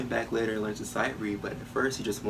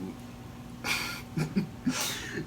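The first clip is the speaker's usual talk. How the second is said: long, breathy chuckle; amused laugh; 7 semitones higher, 3 dB quieter than usual. Acted. Performer recalling his hot-tempered brother, amused laugh. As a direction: let out a quiet, breathy laugh.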